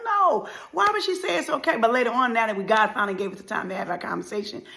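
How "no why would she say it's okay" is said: very high, incredulous objection; acted child's disbelief; disbelieving protest.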